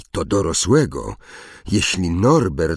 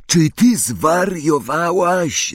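high, drawn-out wail of disbelief; stretched, exasperated howl; 9 semitones higher, slower than usual. A narrator's reading. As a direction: howl it slowly, pitch way up. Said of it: "zawył Ron".

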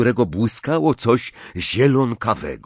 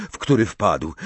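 ordinary delivery, nothing marked

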